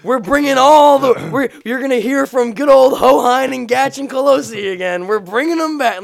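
high, loud, stretched-out promoter parody; mock hype. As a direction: belt out fake excitement for a comeback. Parody of overhyped comeback announcement.

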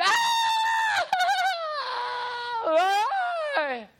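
high mock scream of a hurt little girl; retold scream. Little girl screaming after her brother hits her. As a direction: cry out like a child just punched. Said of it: "she screamed".

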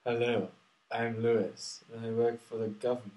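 muffled through cotton wool held at the mouth. Shown as a demonstration. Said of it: "put the cotton wool in front of your mouth".

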